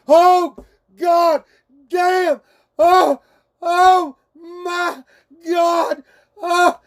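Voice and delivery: high, loud hammed-up climax yell; mock hollering. Retelling his climax to the stream, hammed-up loud hollering. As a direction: reenact the yell at the top of your lungs. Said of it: "smacking the goddamn cabinets and hollering".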